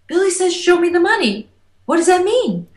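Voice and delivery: high, fast, breathless retelling; high-pitched excited phone call. Retold or imitated.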